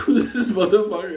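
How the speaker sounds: high, loud incredulous yelp; startled shout. Retold or imitated. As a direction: bark it out in total disbelief. Meeting a strange man again.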